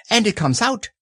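high, small animal voice; high-pitched little chime-in; delighted Piglet. Acted. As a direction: pipe up in wonder. Piglet joins in the wonder.

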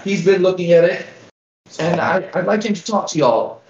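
put-on frail old village elder, worried; a hint of a tiny old lady pleading; very old woman. GM voicing elder Grilda.

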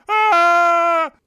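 loud, high wild scream; old man scream. Acted. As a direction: scream out across the prairie.

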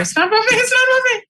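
very high, strained repeat; aunt trying not to cry on camera; fighting tears. Real, not acted. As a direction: squeeze it out high, holding back tears. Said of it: "I'm not gonna cry here".

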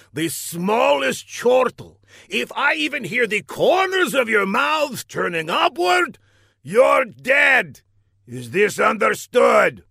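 villain working up to a loud, high rant; furious villain. A narrator's reading. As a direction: threaten the henchmen, building to a shout. Villain threatens henchmen.

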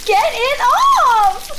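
panicked whine. Acted. Tick still stuck on her.

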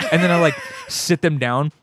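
ordinary talk, nothing marked

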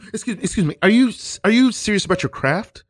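high, prissy mock outrage; indignant acting teacher. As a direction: clutch your pearls as the offended teacher.

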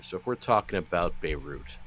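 ordinary talk, nothing marked

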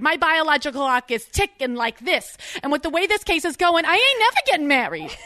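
put-on high Jersey accent building to a loud whine. Fiancée pressing Vinny about marriage, escalating Jersey accent. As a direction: nag in Jersey, climbing to an indignant peak. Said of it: "I'll do it in a Jersey accent".

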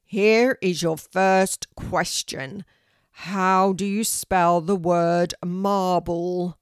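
loud, slow, weighty quiz question; booming tree teacher. A narrator's reading. Oak tree asks Kelty a spelling question, booming. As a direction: boom each word out deliberately. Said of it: "said a deep, loud voice".

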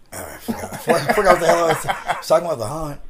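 losing the thread through laughter; amused, giggly recovery; real laughter. Real, not acted. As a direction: laugh while fumbling back to the topic. Lost his thread while laughing.

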